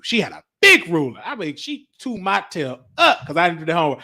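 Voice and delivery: usual speaking voice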